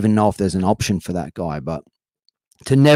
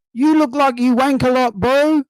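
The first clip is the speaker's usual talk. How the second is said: high-pitched, yelled Kiwi heckle; strong New Zealand accent; 4 dB louder, slower than usual. Retold or imitated. Kiwi heckler yelling at the comedian on stage, strong New Zealand accent. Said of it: "most strongest New Zealand accent".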